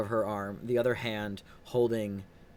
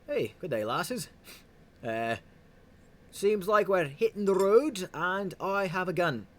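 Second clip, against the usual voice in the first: bar patron voice; 8 semitones higher, 6 dB louder than usual. Acted. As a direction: play the gun-toting patron.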